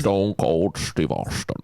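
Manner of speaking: slow, slurred-s film star impression; Sean Connery. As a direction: draw every word out in character. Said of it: "Sean Connery".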